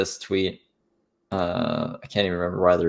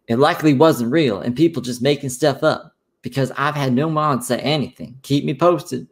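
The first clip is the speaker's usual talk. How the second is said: higher-pitched mocking impression; put-on higher voice of a man in denial; Revzilla voice; 5 semitones higher than usual. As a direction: mimic him doubling down, pitched up. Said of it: "do my Revzilla voice".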